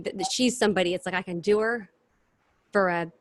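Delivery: ordinary delivery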